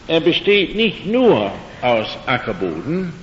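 usual speaking voice

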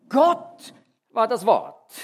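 "Gott war das Wort" slow and loud, "Gott" leaned on hard; double emphasis. Shown as a demonstration. Preacher giving the corrected word order to his congregation, slow and emphatic. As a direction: lay it down slowly, landing on "Gott". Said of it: "Es wird also doppelt betont".

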